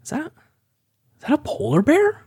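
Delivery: high, surprised question; startled bystander. Acted. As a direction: gasp it out as a startled onlooker. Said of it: "and goes".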